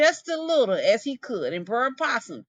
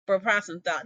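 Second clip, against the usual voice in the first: usual speaking voice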